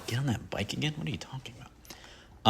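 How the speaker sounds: incredulous whisper. Real, not acted. Mock disbelief at own line.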